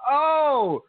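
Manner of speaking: high, loud "oh" of realization; drawn-out oh as it finally clicks; sudden realization. Retold or imitated. Recounting his name mix-up to cohost, loud dawning realization.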